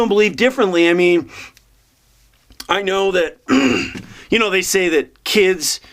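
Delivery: normal talking voice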